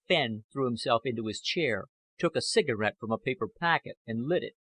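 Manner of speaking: usual speaking voice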